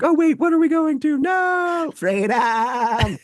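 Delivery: high bleating goat cry. Pretend. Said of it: "Your go impression is m-, impeccable".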